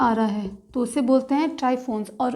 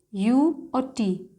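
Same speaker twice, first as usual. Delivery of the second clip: यू और टी spoken very slowly, sound by sound; slow spelling; much slower than usual. Shown as a demonstration. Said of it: "एन". (धीरे-धीरे, एक-एक अक्षर बोलें)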